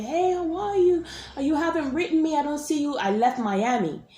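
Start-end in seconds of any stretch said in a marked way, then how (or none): 0.0-3.0 mock sweet guy. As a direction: lightly impersonate the guy's breezy text. Imitating his message a year later.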